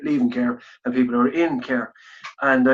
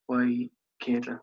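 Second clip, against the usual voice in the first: ordinary delivery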